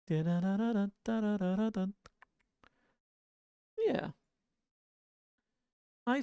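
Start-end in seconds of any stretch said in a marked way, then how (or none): none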